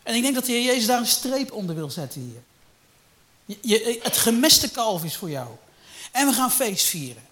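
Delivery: loud, high preaching burst; vurig preken. Real, not acted. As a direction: preach it out with fire. Preaching at full force. (luid en vurig gepredikt)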